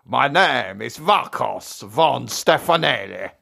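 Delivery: slow, high-pitched hammed-up vampire; put-on spooky count; scary Halloween voice. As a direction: draw the name out like a mock vampire count. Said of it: "That was my scariest, scary voice".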